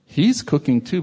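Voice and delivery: ordinary talk, nothing marked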